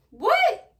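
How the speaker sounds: high, loud, disbelieving "What?"; incredulous what. Retold or imitated. Teen reacting to her baby father's odd request, high and disbelieving. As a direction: shoot it up in disbelief.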